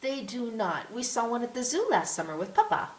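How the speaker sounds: put-on high child voice, insisting; contrary little girl. A narrator's reading. Small daughter argues with her mother about zebras, contrary high child voice. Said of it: "a small, curly-haired girl".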